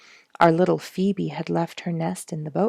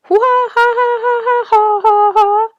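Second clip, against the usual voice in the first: very high, loud cackling loon laugh; wild loon laughter; 12 dB louder than usual. A narrator's reading. Loon laughing wildly over the paddlers, very high and loud. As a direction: let the loon cackle out of control. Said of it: "outburst of the wildest sort of laughter".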